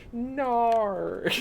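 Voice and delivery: put-on long, slow Aussie-accented groan; mock-dejected Australian accent, drawn right out. Streamer explaining why the Aussie no is funny, dejected Australian accent. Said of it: "like, a really j-, dejected no".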